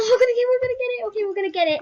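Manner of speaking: fast, high, eager babble; excited rush. Real, not acted. Vlogger hyped before her roll, rushing fast and high.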